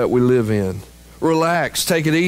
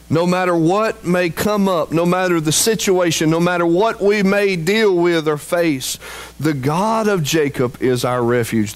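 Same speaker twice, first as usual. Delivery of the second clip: higher, driving preacher's build; fervent preaching; 6 semitones higher than usual. Acted. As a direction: push it up with conviction.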